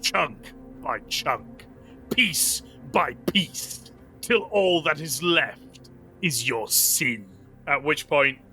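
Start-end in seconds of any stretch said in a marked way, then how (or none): 0.0-7.2 declamatory condemnation, slowing to a grave close; solemn zealous judge, raised pitch then slow; Inquisitor passing sentence. Acted. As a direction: pronounce doom, spacing out the last phrases. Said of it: "the Inquisitor turns back to this young woman".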